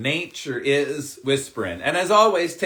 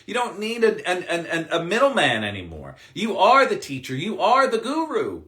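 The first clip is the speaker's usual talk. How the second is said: normal talking voice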